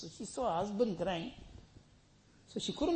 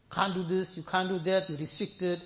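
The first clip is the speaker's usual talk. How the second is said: ordinary talk, nothing marked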